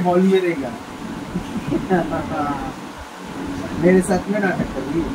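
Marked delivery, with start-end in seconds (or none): 0.8-3.8 laughing. Real, not acted. Husband laughing at pranked wife.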